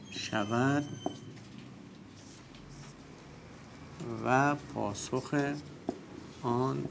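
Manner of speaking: each word set apart, very slow and soft; slow dictation. Shown as a demonstration. Preacher dictates his rule to the class, slow and soft. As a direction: dictate the rule to students word by word, fading away.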